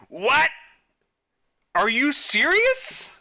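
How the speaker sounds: high, loud, slow outraged disbelief; can't believe the game just did that; shocked disbelief. Real, not acted. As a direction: stretch out a shocked, squeaky are you serious. Shocked by a wrong answer.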